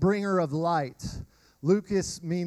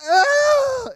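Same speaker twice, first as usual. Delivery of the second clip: much higher, loud wail bursting out; baby's wail; 9 dB louder than usual. Retold or imitated. Imitating a toddler's cry breaking through, loud high wail. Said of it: "babies cry where the first part of it's silent".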